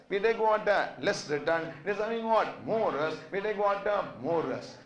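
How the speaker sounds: ordinary delivery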